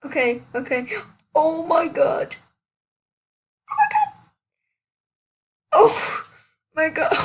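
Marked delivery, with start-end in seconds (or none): 1.3-2.4 slow, awed disbelief; stunned. Real, not acted. Receiving the dream item. 3.7-7.1 high, loud squeal of joy; excited squeal. Real, not acted. Receiving the dream item.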